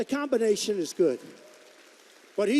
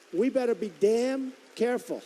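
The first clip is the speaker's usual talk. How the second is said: usual speaking voice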